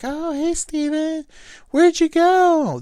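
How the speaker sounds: high, drawn-out tipsy greeting; drunk woman. Retold or imitated. Drunk woman greeting a guy at the bar, slurry high mock voice. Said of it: "This is the drunk".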